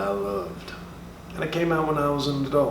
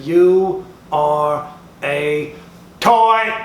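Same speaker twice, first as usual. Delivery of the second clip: slow booming declaration; 8 dB louder, much slower than usual. Acted. Woody getting in Buzz's face, slow booming shout. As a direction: lay down the truth, slow and then hammer it. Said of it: "Said it just like that".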